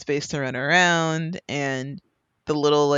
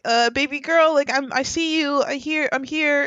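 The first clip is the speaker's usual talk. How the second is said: put-on higher, soothing mother-to-baby tone; mother calming her baby girl in the playpen, higher sing-song; soothing mom voice; 10 semitones higher than usual. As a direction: coo reassurance to the baby, pitched up. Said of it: "I'll be like".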